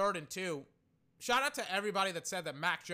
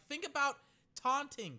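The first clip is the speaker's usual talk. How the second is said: mocking quote; 10 semitones higher than usual. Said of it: "people are like".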